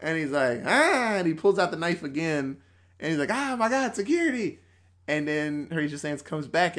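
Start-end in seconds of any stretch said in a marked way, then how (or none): 0.7-1.1 high, loud "ah" yell; short hammed-up scream; mock scream. Chucky is unmasked on Weekend Update, mock scream. 3.3-4.6 high-pitched alarmed cry; mock panic. Colin sees the knife come out, mock panic.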